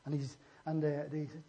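ordinary talk

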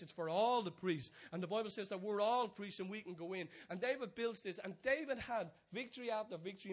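high, loud, driving sermon delivery; rising pulpit fervour; full-force preaching. Acted. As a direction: preach it out to the whole room.

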